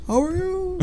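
soft, high echo; imitated echo. Fan plays the echo parroting him, soft and high. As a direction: repeat it as a faraway echo, high-pitched.

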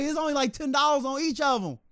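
high meek voice; high mock thief plea; thief pleading. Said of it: "He's like".

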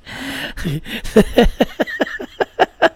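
high, breathless giddy laughter. Real, not acted. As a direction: laugh helplessly at your own joke. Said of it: "my giddiness".

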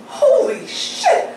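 high-pitched, drawn-out retold shock; stunned realisation. Storyteller recognising the famous family, mock stunned disbelief. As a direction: let the astonishment send your voice way up.

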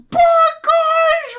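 very high, loud squawked farewell; hammed-up screechy goodbye call; silly phoenix voice. Phoenix saying goodbye before flying off, silly squawk.

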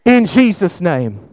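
shouted, high preaching prayer; full-force preacher praying for healing; fervent healing prayer. Real, not acted.

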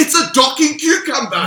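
high, loud insistence; shouting. Real, not acted. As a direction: yell it over them. Shouted argument about zucchini.

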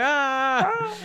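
high-pitched mock scream; drawn-out high comic shriek; imitated scream. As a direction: scream as if startled by each other. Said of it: "they just scream at each other".

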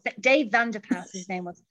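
ordinary talk, nothing marked

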